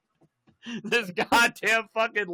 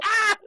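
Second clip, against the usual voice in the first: high, loud mock scream; imitated scream; 5 dB louder than usual. Beaten kid cries out, mock scream. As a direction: scream as if being clubbed, hammed up.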